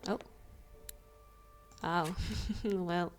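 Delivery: usual speaking voice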